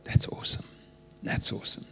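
barely voiced, mouthed under the breath; soft voiceless mouthing to himself; whispered awe, almost no sound; mouthed silently. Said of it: "he just mouthed out the window".

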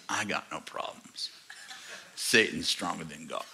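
low, quiet seething retort. Retold or imitated. Possessed man answers the pastor's challenge, low and seething. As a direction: imitate a man seething under his breath.

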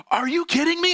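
high, loud imitated outrage; dad's fury. Dad furious at his son's prank, imitated angry yell.

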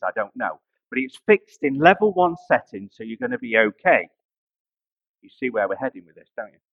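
ordinary talk

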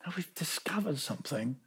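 whispered reveal, almost no voice; hushed whisper. Real, not acted. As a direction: breathe it like a secret to the congregation. Preacher drops to a hushed reveal.

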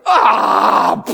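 mock toddler cry, whiny and loud; toddler's wail. Preacher mimicking his toddler refused ice cream, mock wail. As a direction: wail like a two-year-old denied ice cream. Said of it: "they just bother going".

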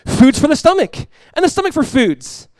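high, loud preached verse; full-force sermon reading; preaching at full force. Acted. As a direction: drive the verse home to the church.

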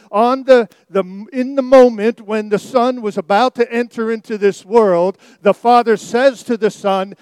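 full-force preaching. Acted. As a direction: preach it out to the congregation, building. Proclaiming scripture to congregation.

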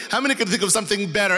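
normal talking voice

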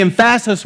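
"emphasis" said em-FASS-is; "emphasis" with a comically wrong middle stress; misplaced stress. Shown as a demonstration. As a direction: punch the second syllable of "emphasis". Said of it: "emphasis on the wrong syllable".

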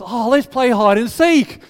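put-on high, loud, slow childish plea; eager little child. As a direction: ask like an excited small kid. Child Andrew voiced.

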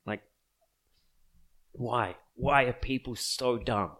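ordinary talk, nothing marked

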